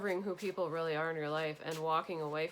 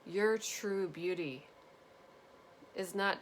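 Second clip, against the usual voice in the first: getting emotional; slower than usual. Real, not acted. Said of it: "this is making me emotional".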